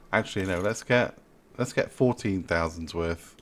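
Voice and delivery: ordinary talk, nothing marked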